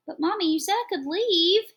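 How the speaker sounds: put-on high, loud little-boy whine; whining child. A narrator's reading. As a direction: whine it like a kid pleading with mommy. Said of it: "he whines".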